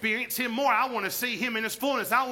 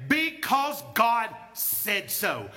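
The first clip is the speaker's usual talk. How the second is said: slow emphatic; much slower than usual. Real, not acted.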